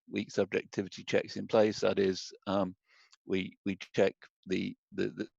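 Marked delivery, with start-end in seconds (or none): none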